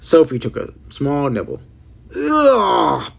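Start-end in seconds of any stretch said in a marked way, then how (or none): 2.1-3.1 high, loud gagging ugh; disgusted ugh. A narrator's reading. Girl tasting foul vegetable, high loud gagging ugh. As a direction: spit out a revolted ugh. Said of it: "she sputtered".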